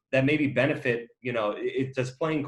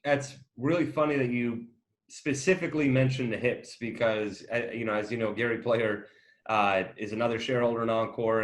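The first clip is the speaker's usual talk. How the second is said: normal talking voice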